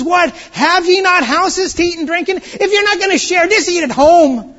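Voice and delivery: loud, high, indignant demand; exasperated apostle scolding the church; scolding rebuke. Acted. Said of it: "And so he says".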